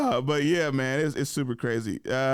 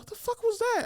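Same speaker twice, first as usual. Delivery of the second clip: very high-pitched retold disbelief; incredulous reaction. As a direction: replay your bafflement in a high voice. Retold reaction to a mean girl.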